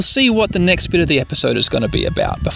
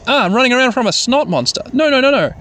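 excited gamer; 8 semitones higher than usual. Real, not acted. Excited running through game area.

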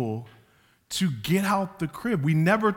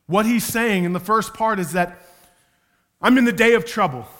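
preaching at full force; 3 semitones higher, 7 dB louder than usual. Real, not acted.

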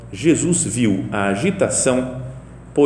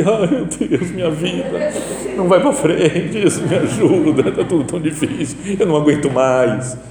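louder, higher hammed-up moaning prayer; mock whining prayer; 4 semitones higher, 3 dB louder than usual. Preacher mocking people who only complain to Jesus, loud whining. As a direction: whine it like a tired complainer at church. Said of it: "Depois chega aqui".

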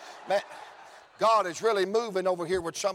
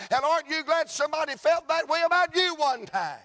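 fervent preaching; 5 semitones higher than usual. Acted. Preaching at full force.

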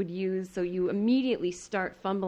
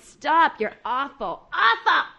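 louder, higher judge's dismissal; imitating a judge cutting her off; mock heckle; 6 semitones higher, 6 dB louder, much slower than usual. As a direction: bark a harsh verdict. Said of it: "And then Ant went".

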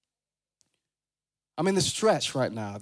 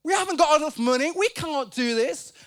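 incredulous; 8 semitones higher than usual. Retold or imitated. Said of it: "Philip's like".

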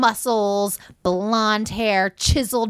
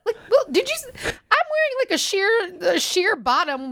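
indignant disbelief; 11 semitones higher than usual. Retold or imitated. Outraged at door guy.

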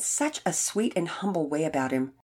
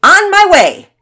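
high, drawn-out holler; loud declaration; 13 semitones higher, 16 dB louder, slower than usual. A narrator's reading. Todd setting off toward the bowl, declaring loudly. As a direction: call it out to the whole park. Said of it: "Todd declared".

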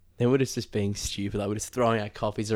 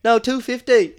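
high, loud put-on shopkeeper accent on "No, two fifty."; mock shopkeeper accent; 13 semitones higher, 10 dB louder, slower than usual. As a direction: insist on the price in a hammed-up local shopkeeper accent. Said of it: "I'll do the accents and stuff".

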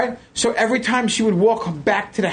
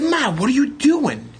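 high, slow disbelieving question; can't believe what mom's doing; high, drawn-out disbelief; incredulous; 7 semitones higher than usual. Retold or imitated. Retold confronting mother.

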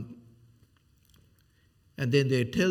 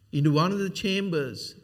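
mock misquote of scripture; 6 semitones higher, 6 dB louder than usual. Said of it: "That's not what verse two says".

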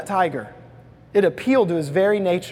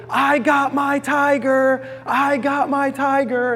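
mock joyful shout of a boy; delighted yell; 7 semitones higher, slower than usual. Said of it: "yelling and running and delighted".

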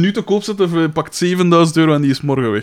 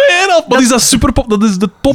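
indignant excitement; 9 semitones higher, 4 dB louder than usual. Real, not acted. Defending the guitar, indignant.

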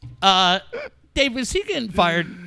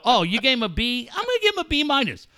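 higher-pitched, quick counter-offer; mock disagreement; 3 semitones higher, faster than usual. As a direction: lift the voice as if differing. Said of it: "never once did either one of those two dickheads go".

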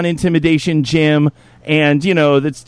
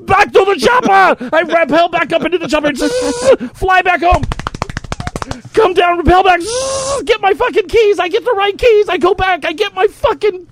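high-pitched, breathless mock action tale; hyped-up story; 13 semitones higher than usual. Spinning a wild chopper tale to friends, hyped and high. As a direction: pitch it up and ham every beat. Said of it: "I got so excited".

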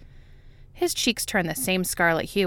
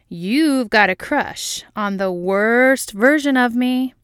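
slow teasing sing-song, louder; sing-songy; 5 semitones higher, 8 dB louder, slower than usual. A narrator's reading. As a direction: tease it in a lilting tune. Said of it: "in a sing-songy voice".

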